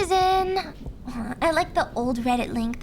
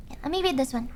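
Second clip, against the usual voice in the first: ordinary delivery, nothing marked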